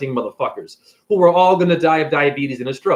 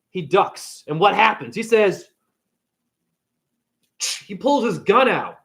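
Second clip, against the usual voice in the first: normal talking voice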